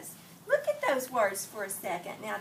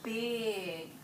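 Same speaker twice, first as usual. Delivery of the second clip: very slow, savoring read-aloud; slow dramatic picture-book reading; slow story reading; much slower than usual. Acted. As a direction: draw each word out for the children. Teacher reads picture book aloud.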